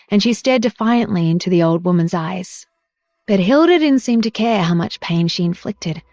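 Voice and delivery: ordinary delivery, nothing marked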